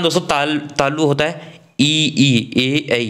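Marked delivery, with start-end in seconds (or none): 1.8-3.0 ई, ई, ए, ऐ sounded from the palate; slow palatal vowels ई, ए, ऐ; palatal vowel demo. Shown as a demonstration. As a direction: demonstrate ई and ऐ with the tongue on the palate. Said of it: "इस बार तालु से बोला जाता है".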